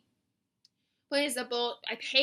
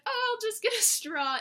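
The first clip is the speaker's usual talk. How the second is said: breezy decision; 10 semitones higher than usual. Retold or imitated. Retelling her casual decision.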